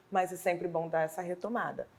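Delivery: ordinary talk, nothing marked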